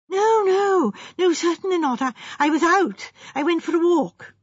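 high, flustered denial; agitated young gentleman on the defensive; nervous young architect. Acted. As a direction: stammer out a worried denial.

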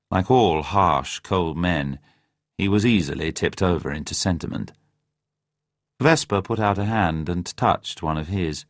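ordinary delivery

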